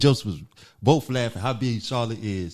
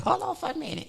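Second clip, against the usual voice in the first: much higher, softer put-on old lady; 6 dB quieter, faster than usual. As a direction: keep up the old-lady disguise, softly. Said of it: "Just sound like an old lady".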